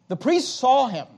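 ordinary delivery

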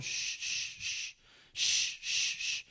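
soft unvoiced shushing; mother shushing. Mother shushing her frightened daughter, soft hiss. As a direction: hush a scared child on a plane. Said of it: "you hear the mom going".

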